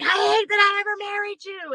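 high, strained retold scream. Ex-husband leaving the courtroom, screaming in rage. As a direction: scream it up at the top of the voice. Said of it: "he was screaming at the top of his lungs".

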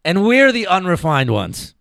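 loud sarcastic exclamation after hearing the chant; sarcastic outburst. Real, not acted. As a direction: cry out in sarcastic disbelief.

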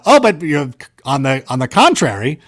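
usual speaking voice

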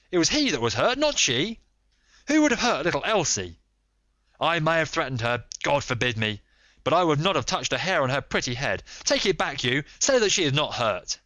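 high, loud and desperate, pleading in anger; fierce protest. A narrator's reading. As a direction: shout the denial at the detectives. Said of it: "he cried fiercely".

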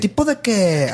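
northern Mexican fresa intonation; posh regio girl voice; Monterrey fresa accent. Retold or imitated. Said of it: "Así, es de fresa, de fresa regio". (acento regio fresa)

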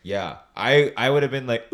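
ordinary talk, nothing marked

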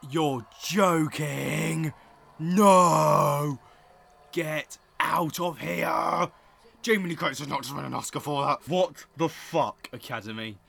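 loud, high, slow incredulous shouting; real outraged disbelief. Real, not acted. Vlogger reacting to the Oscar result, loud outraged disbelief. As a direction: yell in stunned outrage. Outraged at Jamie Lee Curtis win.

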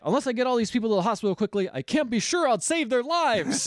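high urgent doctor; hammed-up doctor line, then slowing down; dramatic doctor voice.

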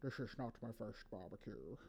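low, soft slurred Scottish impression; Sean Connery. Retelling Connery's reply to his co-stars, in imitated Scottish voice. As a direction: do his Scottish accent, dropped low and quiet. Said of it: "Connery turned to them and said".

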